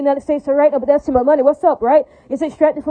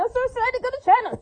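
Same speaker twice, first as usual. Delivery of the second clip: high, soft, put-on giddy voice; mock excitement; 9 semitones higher, 5 dB quieter than usual. A ranter mocking his own trip abroad in mock glee. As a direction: sarcastically gush like a thrilled tourist. Sarcastic aside about leaving America.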